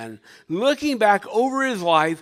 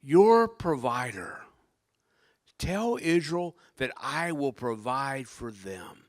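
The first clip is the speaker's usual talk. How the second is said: slow, lowered divine assurance; slow, grave promise after the thunder; solemn voice of God; 4 semitones lower, slower than usual. Acted. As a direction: bring God's answer down to a measured calm. God's answer continues, subdued after shout.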